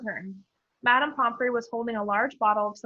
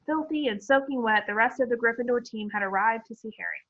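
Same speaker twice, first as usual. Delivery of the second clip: ordinary delivery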